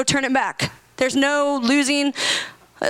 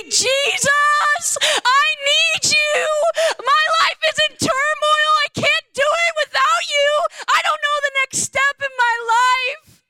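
crying out to God; 12 semitones higher than usual. Shown as a demonstration. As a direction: tear your heart open with your voice. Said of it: "I rend my heart with sound".

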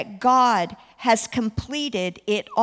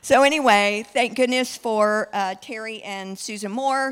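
usual speaking voice

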